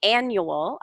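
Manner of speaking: annual said slowly, stress on AN; annual, ANN-yoo-ul; teaching pronunciation. Shown as a demonstration. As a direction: model annual clearly for the student. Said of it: "words I wanted to go over".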